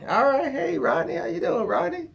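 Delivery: high, overly friendly hello; lightly voiced nervous friendliness; eager greeting. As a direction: gush back at the uncle. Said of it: "greeting the uncle".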